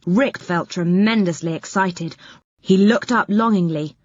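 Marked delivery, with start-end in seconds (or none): none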